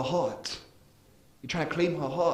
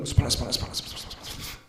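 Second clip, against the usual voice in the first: murmured prayer that fades into a whisper; fading private prayer; 4 semitones lower, much faster than usual. Retold or imitated. Preacher imitating himself praying alone, fading to a whisper. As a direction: let the prayer sink into a breath.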